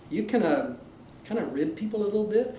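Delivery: ordinary talk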